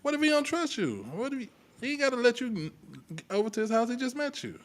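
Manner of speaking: ordinary talk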